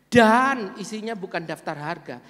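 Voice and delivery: normal talking voice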